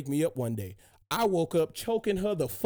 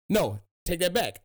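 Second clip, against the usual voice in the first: ordinary talk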